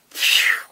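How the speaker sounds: breathy falling whoosh; airy sound effect of a collapse; imitated whoosh. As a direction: blow out air like something swept away. Imitates company going down the drain.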